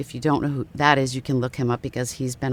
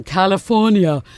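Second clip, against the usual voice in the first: slow, louder Austrian "California"; Schwarzenegger accent; 4 semitones higher, 4 dB louder, slower than usual. Retold or imitated. Repeating the governor's Austrian "California" again. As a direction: stretch out "California" Austrian-style. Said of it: "I just find that fascinating".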